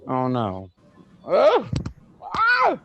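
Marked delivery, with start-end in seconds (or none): 1.3-2.8 sharp high yelp of revulsion; disgusted shudder noise. Real, not acted. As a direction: recoil with a loud "ugh" and squeal. Shudders at the thought of snakes.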